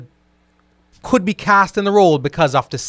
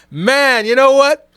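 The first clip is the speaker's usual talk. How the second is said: sudden higher, louder burst; excited outburst; 10 semitones higher, 7 dB louder, slower than usual. Real, not acted. To David's Superman comment, an excited louder burst. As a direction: jump in, fired up to correct the chat.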